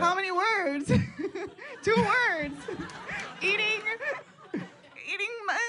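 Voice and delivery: giggling high while guessing; laughing through the words; laughing hard. Real, not acted.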